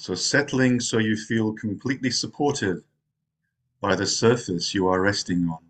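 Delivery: unhurried meditation guide, long pauses between phrases; guided meditation. Real, not acted. Zen teacher settling meditators into rest, guided meditation voice. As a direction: ease them into rest, phrase by phrase.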